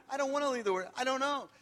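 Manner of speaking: imitating Janet refusing.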